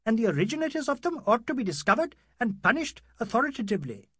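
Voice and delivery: pompous outrage building to a demand; indignant doctor. Acted.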